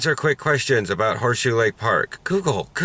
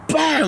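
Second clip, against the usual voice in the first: sharp bam. Retold or imitated. Mimicking a cleat spike.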